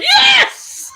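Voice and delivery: shrieks yes loud and high; triumphant yell. Real, not acted. Mother hearing the guilty verdict, ecstatic shout. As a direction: let it burst out, overjoyed.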